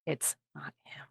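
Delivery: whispered insistence. Acted. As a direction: repeat it under your breath, secretively. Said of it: "she just repeats it".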